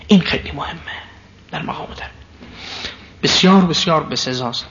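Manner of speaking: ordinary talk, nothing marked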